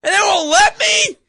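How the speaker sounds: very high, outraged shouting; hammed-up yelling. Shut-out moviegoer protesting to co-host, indignant yelling. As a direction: wail it like a mock tantrum. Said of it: "Why are you yelling?".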